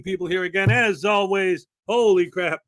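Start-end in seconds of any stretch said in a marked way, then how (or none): none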